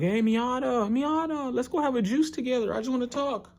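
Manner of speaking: sing-song high plea to a friend; pleading Ippo voice. Retold or imitated. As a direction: Ippo chasing Miyata, begging him to talk, high whiny. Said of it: "was like".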